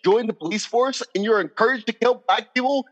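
rising anger. Real, not acted. Man venting to friends about police killings, growing angry. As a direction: let the outrage climb line by line. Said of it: "And I'm getting angry".

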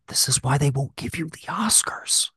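hushed, scolding aside, slowing down at the end; whispered mock reprimand. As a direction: hiss it like a fed-up critic under his breath. Mock scolding Bradley Cooper in his head.

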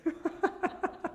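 high, breathy chuckle; short voiceless run of chuckles; amused laugh. Real, not acted. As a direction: laugh at your own annoyance. Laughs while telling of annoyance.

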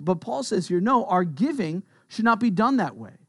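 ordinary talk, nothing marked

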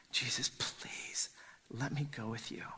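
desperate whispered request; whispered plea. Acted. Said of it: "And he begs Jesus".

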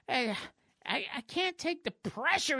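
high, pleading nerd voice; nerdy weakling. Acted. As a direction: stammer it as a cartoon weakling. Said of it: "reverts back to his nerdy ninety-eight pound prepubescent form".

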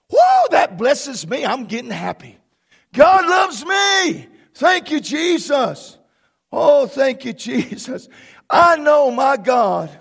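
loud ecstatic praise; joyful preaching. Real, not acted. Pastor rejoicing before the church, loud and joyful. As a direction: let the joy overflow.

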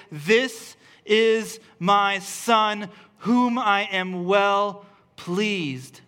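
very slow, weighty proclamation; solemn voice of God. Acted. Pastor quoting God at the baptism, very slow and solemn. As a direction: draw out each word like a voice from heaven.

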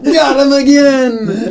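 high, drawn-out crowing; gloating prankster, played for laughs; playful taunt. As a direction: call it out slowly in mock triumph. Film teasing its audience.

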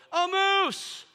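high, loud burst of amazement; a sudden high, loud cry of surprise; excited exclaim. Retold or imitated. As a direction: blurt it out like you can't believe your eyes. Retold sighting of moose.

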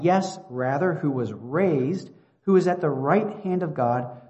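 forceful scripture reading. Real, not acted. Preacher reading God's defence of the elect to listeners, forceful scripture reading. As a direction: proclaim each phrase with deliberate weight.

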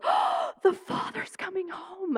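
high, slow, hushed thrill; hushed excitement. Retold or imitated. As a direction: breathe it out, thrilled the father's near. Son announcing father's arrival, excited hush.

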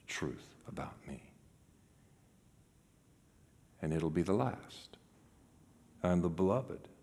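very low, near-whispered ending; hushed close. Real, not acted. Preacher closing to the congregation, very quiet and low. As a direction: drop to a hush, pausing between phrases.

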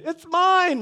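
high, loud proclaiming of ownership; loud claim. Retold or imitated. Claiming the land past the fence, loud and high. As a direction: announce it to the whole yard. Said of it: "really loud".

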